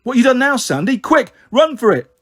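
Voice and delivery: high, loud lad raising the alarm; panicked yell. A narrator's reading. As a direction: yell at your mates to flee. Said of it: "the skinhead yells".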